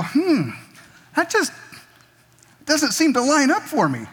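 high, puzzled doubting musing; skeptical hmm. Retold or imitated. Pastor mimics his doubt about Bill: high skeptical musing.

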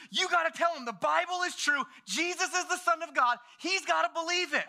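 put-on high, insistent child voice; high-pitched, overexcited little preacher; worked-up kid. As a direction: imitate a boy frantic to convince. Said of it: "Settle down, Landon".

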